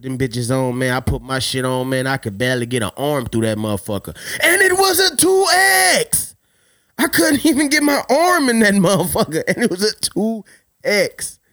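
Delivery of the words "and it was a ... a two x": real shocked outburst; incredulous shout. Real, not acted. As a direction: yell it like you can't believe it. Disbelief at the shirt size.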